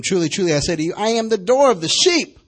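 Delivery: loud, ringing read of "door of the sheep" with a rise on "sheep"; proclaimed scripture. Acted. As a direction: announce it to the whole church. Reading scripture.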